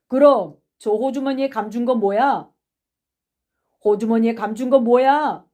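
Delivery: loud accusing question, repeated; demanding. Acted. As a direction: press the suspect for an answer.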